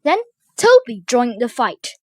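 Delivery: plain reading voice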